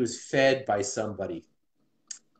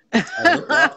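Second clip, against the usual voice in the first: laughing; 9 dB louder, slower than usual. Real, not acted. Retelling a funny surprise.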